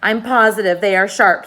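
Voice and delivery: insistent. A narrator's reading. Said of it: "said the earthworm".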